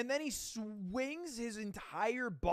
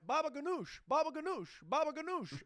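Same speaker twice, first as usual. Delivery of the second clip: put-on higher, sing-song kid gibberish, repeated; mock kid babble; 9 semitones higher than usual. Streamer imitating a fleeing kid in a clip, higher mock babble. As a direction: imitate a panicked toddler babbling nonsense. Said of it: "The kid j-, literally went".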